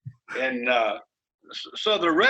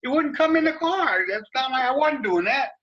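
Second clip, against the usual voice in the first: much higher, pleading it's not his fault; defensive excuse; 9 semitones higher than usual. Retold or imitated. Driver explaining his arm out the window, mock defensive pleading. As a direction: whine out the excuse. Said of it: "I said".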